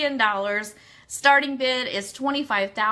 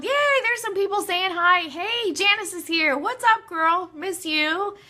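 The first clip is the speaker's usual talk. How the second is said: light up as each friend shows up; excited greeting; 8 semitones higher than usual. Real, not acted. Host waving hi to chat viewers, excited.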